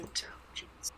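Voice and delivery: breathy whispered word. Real, not acted. Player muttering the check name, whispered. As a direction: whisper it to yourself.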